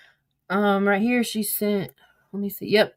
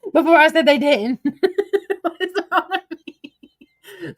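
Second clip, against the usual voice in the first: laughing; 7 semitones higher, 5 dB louder, slower than usual. Real, not acted. Laughing at her own message.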